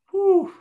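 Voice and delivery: sudden loud, high-pitched "whew"; acted-out amazed whew; high whooshing whew. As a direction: let out a piercing whoosh. Acts out his reaction to voice.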